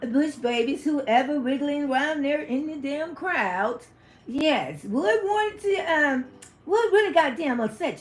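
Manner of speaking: a lightly put-on auntie voice spilling the tea; put-on gossip voice. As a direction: play the gossiping character, half amused. Put-on gossip voice telling story.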